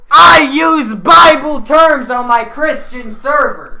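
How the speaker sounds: slow, high, shouted proclamation; mock preachy shout. Mock-pious joke claim.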